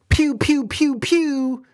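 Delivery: high pew-pew sounds, then playful taunt; mock word-shooting. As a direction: pretend to fire words like a toy gun. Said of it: "with your words saying".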